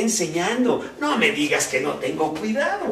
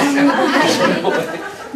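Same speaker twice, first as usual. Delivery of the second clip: hearty laugh; 12 semitones higher, 11 dB louder than usual. Real, not acted. Laughing at own joke.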